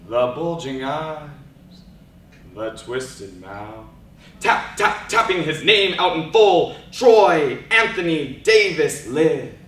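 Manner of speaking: rhythmic poem, rising to loud chanted taps; spoken word poetry. Acted. Poet performing for the audience, rhythmic spoken word. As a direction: perform it like a slam poem, building.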